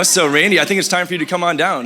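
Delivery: ordinary talk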